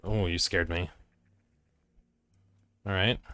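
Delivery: ordinary talk, nothing marked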